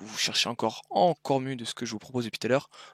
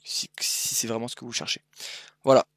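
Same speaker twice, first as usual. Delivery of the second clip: usual speaking voice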